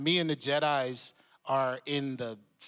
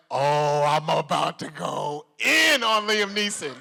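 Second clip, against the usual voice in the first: gleeful; 7 dB louder than usual. Retold or imitated. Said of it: "I was like".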